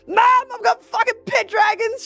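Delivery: high, loud, thrilled announcement; excited shout. Retold or imitated. On the phone to her mum, a high loud excited shout. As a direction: blurt it to mum at full volume.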